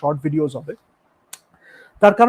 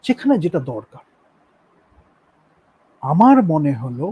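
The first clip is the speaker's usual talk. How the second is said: normal talking voice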